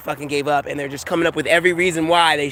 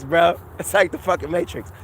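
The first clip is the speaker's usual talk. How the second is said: usual speaking voice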